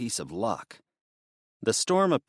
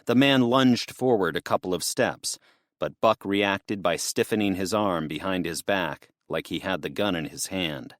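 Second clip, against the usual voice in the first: ordinary delivery, nothing marked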